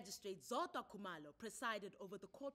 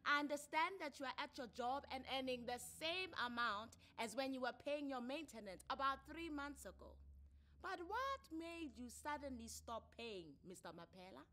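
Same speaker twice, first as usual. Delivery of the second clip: raised, firm official voice; counselor's questions; 7 semitones higher, 7 dB louder than usual. Acted. Counselor questioning a father who stopped paying, firm. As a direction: press him like a family court counselor.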